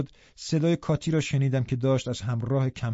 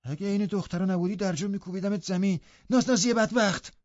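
higher voice flaring into a threat; angry; 7 semitones higher than usual. Acted. Hareton angrily threatens Linton, rising at the end. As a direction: threaten the sissy. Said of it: "جوان عصبانی گفت".

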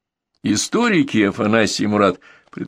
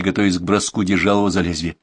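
ordinary delivery

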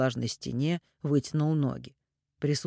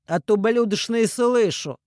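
loud, slow mocking retort; teasing; 7 semitones higher, 7 dB louder than usual. A narrator's reading. Man throws the insult back at Ирина, teasing. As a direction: taunt her right back. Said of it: "Он рассмеялся, дразня Ирину".

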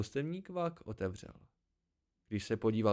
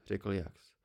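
usual speaking voice

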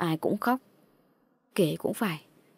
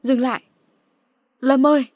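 higher, louder cry to stop; urgent call; 5 semitones higher, 7 dB louder than usual. Acted. Calling to Lâm to stop, urgent cry.

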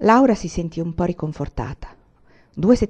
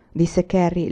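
plain reading voice

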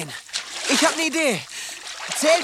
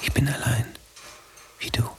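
hushed whisper; 11 semitones lower, 5 dB quieter than usual. Acted. Inner whispered voice.

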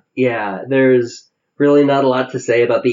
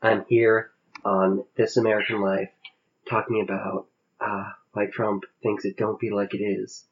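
softer, lower public radio host voice; Ira Glass; 5 semitones lower, 10 dB quieter than usual. Acted. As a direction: ease into a hushed radio host murmur. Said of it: "that's a pretty good Ira Glass".

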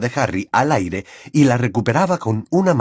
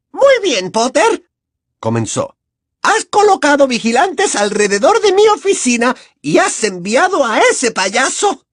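gloating villain; 5 dB louder than usual. Acted. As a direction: savor the victory primly. Umbridge accuses.

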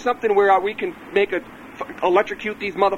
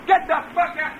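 high, angry yell at an intruder; shouting off the phone; 6 semitones higher than usual. Real, not acted. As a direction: yell away from the receiver to chase someone off. Yelling at trespassers away from phone.

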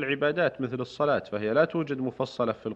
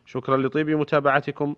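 formal radio announcer read; crisp, measured sign-off announcement; formal standard arabic; 5 dB louder than usual. Acted. Radio host's closing announcement.

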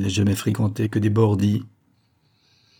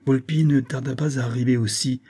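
ordinary delivery

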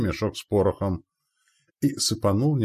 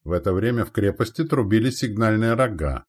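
ordinary delivery, nothing marked

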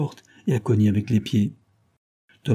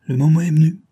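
louder, higher call to go; commanding criminal's voice; stern summons; 7 semitones higher, 7 dB louder, faster than usual. Acted. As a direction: announce it firmly.

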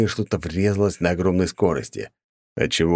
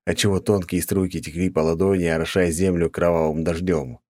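ordinary delivery, nothing marked

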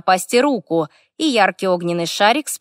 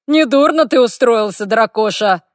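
loud, higher, teasing orc voice; guffawing orc; 3 semitones higher, 5 dB louder than usual. A narrator's reading. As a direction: rib your friend boisterously. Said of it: "гоготнул орк". (громкая насмешка орка)

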